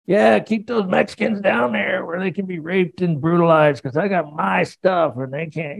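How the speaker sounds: preacher mocking a gun-toting wall-builder Jesus; mock angry gun-toter. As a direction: ham up a callous bigot. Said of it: "Is he this angry, gun-toting".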